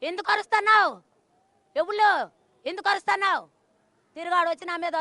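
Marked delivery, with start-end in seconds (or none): 0.0-3.4 loud, much higher mock yell; hurling the owners' angry question back; imitating the owners' yelling. Widow imitating the owners shouting at her, loud and high. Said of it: "ఓనర్లే".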